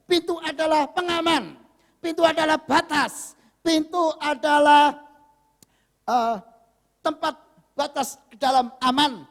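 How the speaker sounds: high, emphatic preaching declarations; full-force preaching. Real, not acted. Preacher defining the door to congregation, proclaiming.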